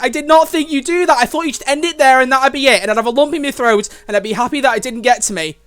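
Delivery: worked-up lament; emotional outburst. Real, not acted. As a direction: complain loudly to the writer. Emotional reaction to story twist.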